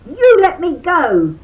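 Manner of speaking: high, loud rabbit yell; furious high rabbit shout. A narrator's reading. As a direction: yell at the stuck tar baby. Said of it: "Brer Rabbit yelled".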